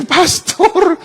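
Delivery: mimics her high frightened voice on the phone; a woman phoning the pastor after the earthquake, still shaken; high shaken call. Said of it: "me llamó por teléfono".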